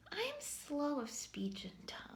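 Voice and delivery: whispered line of scripture. Acted. Reading Moses' confession to God in a whisper. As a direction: hush it as if confessing.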